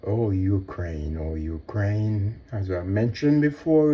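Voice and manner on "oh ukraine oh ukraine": slow, low, softer lament; slow poetic sigh over Ukraine; mock poetic lament. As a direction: recite it like a sorrowful poet. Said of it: "if I was a poet".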